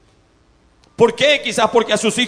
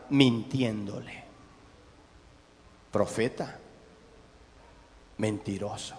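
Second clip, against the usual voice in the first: low, soft, slow words; hushed accusation; 9 semitones lower, 10 dB quieter, slower than usual. Acted. Preacher exposing the lying prophet, soft and low. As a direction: drop it almost to a secret. Preacher hissing out the liar's label.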